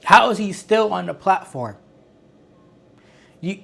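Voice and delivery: normal talking voice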